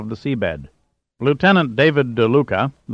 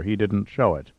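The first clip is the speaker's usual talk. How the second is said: ordinary delivery, nothing marked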